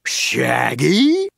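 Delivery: low, puzzled monster question; a lightly voiced creature asking. Monster Scooby asks, low voice.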